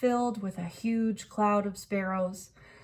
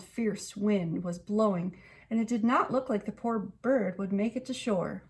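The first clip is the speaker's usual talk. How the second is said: plain reading voice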